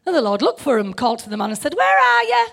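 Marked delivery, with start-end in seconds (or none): 1.7-2.5 high, loud calling shout. Shown as a demonstration. Preacher shows God calling Adam as a loud shout. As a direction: shout it across the garden. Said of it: "Who thinks that that might have been it?".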